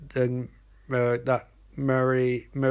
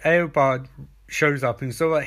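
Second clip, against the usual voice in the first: ordinary talk, nothing marked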